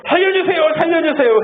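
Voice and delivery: high mock plea of a frail old man; old man wailing. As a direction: whine it like the old man in the night. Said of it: "해요". (높은 목소리로 살려달라는 할아버지 흉내)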